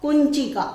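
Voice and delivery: कुंचिका said high and loud, each syllable crisp; teacher calling out कुंचिका to be repeated; clear pronunciation demo. Shown as a demonstration. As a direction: model कुंचिका loudly for the class to repeat. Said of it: "इसका उच्चारण करना है हमको".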